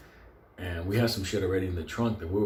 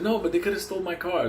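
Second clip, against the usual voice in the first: higher-pitched protest; 8 semitones higher than usual. Retold or imitated. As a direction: protest like an upset car owner. Acted protest of car owner.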